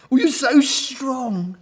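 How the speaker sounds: high, slow, gushing compliment; admiring. A narrator's reading. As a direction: swoon over the hero. Gorillas swoon over hero Gordon.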